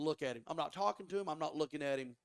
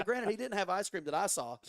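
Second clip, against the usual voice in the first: ordinary talk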